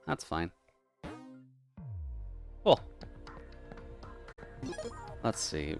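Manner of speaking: usual speaking voice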